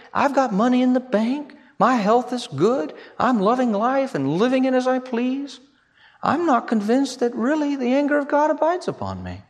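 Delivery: ordinary talk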